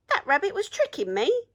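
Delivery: mock outrage at being fooled; indignant troll. A narrator's reading. Troll realising the rabbit tricked him, indignant.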